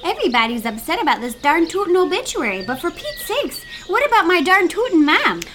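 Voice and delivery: put-on broad Minnesota accent, aggrieved. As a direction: daughter upset about her mother's obituary, Minnesota accent. Minnesotan folks bickering on air.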